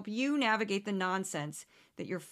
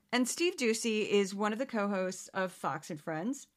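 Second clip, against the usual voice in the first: ordinary talk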